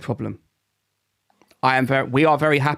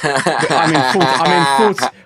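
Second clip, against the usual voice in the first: laughing; 5 dB louder than usual. Real, not acted. Laughing at his own low rank.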